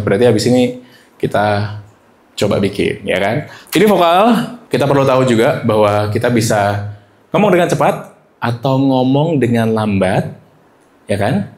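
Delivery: ordinary talk, nothing marked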